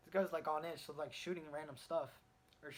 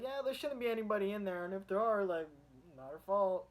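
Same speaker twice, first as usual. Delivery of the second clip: higher and louder, careless cop voice; 5 semitones higher, 8 dB louder than usual. Retold or imitated. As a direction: toss it off loudly like a callous cop. Cop shrugging off bombing people.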